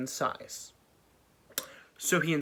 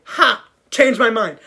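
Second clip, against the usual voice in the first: loud, higher mocking gloat; gloating Pharaoh; 6 semitones higher, 10 dB louder than usual. Pharaoh reversing his decision, loud mocking gloat. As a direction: crow it triumphantly.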